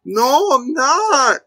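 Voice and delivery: high, slow whimpering clown voice; whiny hurt clown. Retold or imitated. Mocking Pennywise whimpering at the insults, high and whiny.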